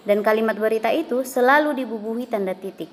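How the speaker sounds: ordinary talk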